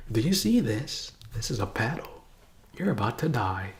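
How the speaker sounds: put-on quietly scare the kid; menacing teacher. Teacher intimidating child with paddle.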